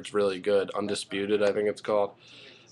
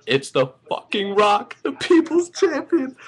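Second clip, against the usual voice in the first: ecstatic, very high fan squeal; high, drawn-out cry of joy; overjoyed; 3 dB louder than usual. Real, not acted. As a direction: let the voice soar in real elation. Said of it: "I'm beyond happy right now".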